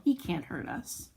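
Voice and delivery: ordinary delivery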